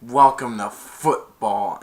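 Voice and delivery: loud, slow, punched words at normal pitch; retold taunt. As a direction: sock it to a tackled rookie. Tackler's taunt, later thrown back.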